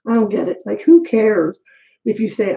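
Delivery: normal talking voice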